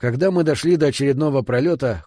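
ordinary delivery

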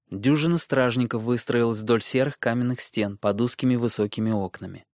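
usual speaking voice

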